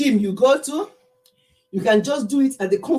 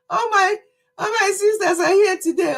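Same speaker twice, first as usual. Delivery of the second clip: high, excited shout-out; 9 semitones higher than usual. Real, not acted. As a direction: greet them with joy. Excited greeting to sisters.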